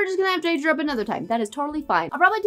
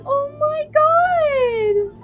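crying; much slower than usual. Real, not acted. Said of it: "screaming and crying".

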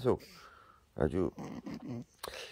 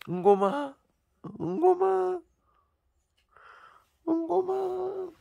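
calling the name ever higher, stretched and slow; pleading call; slower than usual. Real, not acted. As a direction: wail after the cat as it walks away. Calling the leaving cat. (떠나는 고양이를 점점 높게 애타게 부르며)

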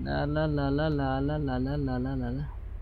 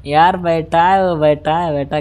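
louder, still buzzing from laughing; excited; 2 semitones higher, 7 dB louder than usual. Real, not acted. Gamer telling viewers the enemy is sitting there, excited. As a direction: say it thrilled.

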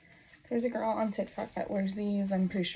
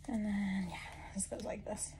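soft unvoiced whisper; 8 dB quieter than usual. Real, not acted. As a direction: breathe the words out. Whispering while fixing harness.

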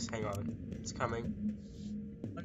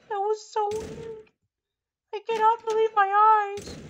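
squeaky voice; 5 dB louder than usual. Acted. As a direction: squeak it out like a tiny creature. High put-on voice repeat.

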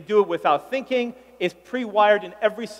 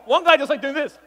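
ordinary talk, nothing marked

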